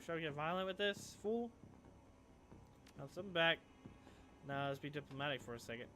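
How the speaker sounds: ordinary talk, nothing marked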